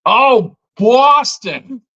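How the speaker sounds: high, loud mock Boston accent. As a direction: exclaim it in a put-on Boston accent. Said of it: "I like the Boston accent".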